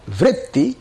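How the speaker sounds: "vritti" with a rolled r and a doubled t; VRIT-ti, rising, with a held t; staccato. Shown as a demonstration. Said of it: "So one more time".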